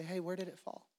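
soft, gentle asking, never a shout; gentle question. Retold or imitated. The man of God gently asks the fallen man, soft and kind. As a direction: ask it softly like a caring friend. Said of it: "just very gently say".

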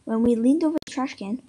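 ordinary delivery, nothing marked

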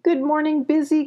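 high, sing-out greeting; calling out. A narrator's reading. Molly greets Busy as the owl swoops down, calling out. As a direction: call a greeting up high, not shouted. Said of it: "called Molly".

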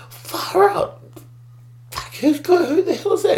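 high, amazed exclaim; astonished. Retold or imitated. Seeing a rider drop in, a high astonished exclaim. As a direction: let it jump up in pitch with disbelief.

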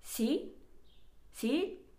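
し？ with a rising end, like answering a call; rising phone sí. Shown as a demonstration. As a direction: say し？ lifting at the end. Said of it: "こう言うんですよね".

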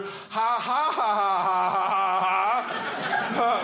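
mocking cop laughing down the phone; officer laughing. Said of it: "I pick up the phone and I hear".

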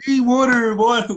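high mock proper-English accent. Friend teasing co-host's English, high mock-proper accent. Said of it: "make fun of me because I speak proper English".

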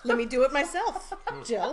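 put-on loud, laughing annoyed kid; pestering child. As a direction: snap back like a fed-up kid. Imitating daughter answering dad.

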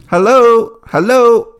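loud call into empty room. Real, not acted. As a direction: shout hello to find someone. Calling out at empty vets.

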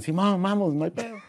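girl voicing the friend dolls, mock casual; doll friends agreeing. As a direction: play the easygoing doll friend. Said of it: "las amigas decían".

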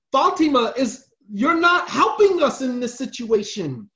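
high, loud pleading push; urging wives. Retold or imitated. The wives urge Fatima to return, loud and insistent.

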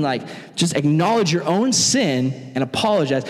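ordinary delivery

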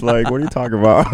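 quick, laughing and high. Real, not acted. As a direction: laugh while asking. Laughing at own joke.

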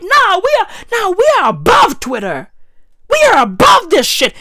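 high, lofty "we are above this"; snooty quote. Retold or imitated. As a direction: sniff down at Twitter. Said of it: "They were like".

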